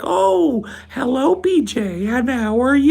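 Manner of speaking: high, drawn-out syrupy greeting; sugary polite host. Retold or imitated. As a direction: gush over the guest like an overly sweet parent. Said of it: "they go like".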